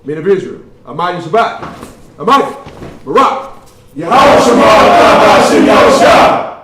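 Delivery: shouted salute. Real, not acted. Leader gives the salute he just taught, shouted at full force. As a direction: bellow each call, then belt the last line. That's right after we say our salute.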